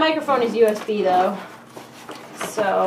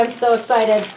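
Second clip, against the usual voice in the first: ordinary delivery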